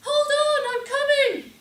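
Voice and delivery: shrieked high and very loud; weedy lady's shout. Retold or imitated. Would-be rescuer pumping her arms, shouting in a little weedy voice. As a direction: yell ahead that help is on the way. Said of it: "in my little weedy lady's voice".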